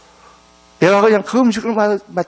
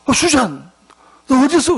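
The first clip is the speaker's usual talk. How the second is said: rising, louder alarmed question; a startled, urgent call to a runaway girl; 8 semitones higher, 6 dB louder than usual. Retold or imitated. Preacher answers runaway's call, surprised.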